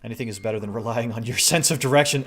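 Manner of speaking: ordinary talk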